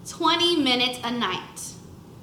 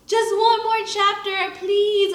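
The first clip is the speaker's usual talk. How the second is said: higher, pleading plea; begging; 7 semitones higher, 4 dB louder than usual. A narrator's reading. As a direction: beg mom for one more chapter. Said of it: "I begged my mom".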